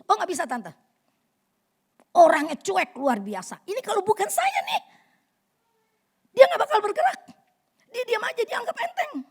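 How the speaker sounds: high, loud fierce complaint about her husband; fierce wife. Retold or imitated. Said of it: "istrinya galak banget". (istri galak mengeluh dengan nada tinggi)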